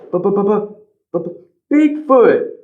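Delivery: put-on boy too frightened to get the word out; frightened stammer. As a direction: stammer the name, too scared to speak. Ben meets Bigfoot, speechless.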